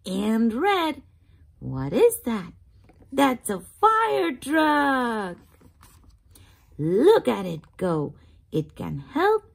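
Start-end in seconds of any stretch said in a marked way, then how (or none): none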